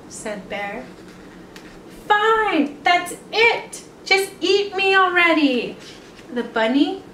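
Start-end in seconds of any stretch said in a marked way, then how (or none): 2.1-5.8 frustrated. Acted. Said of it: "he's frustrated".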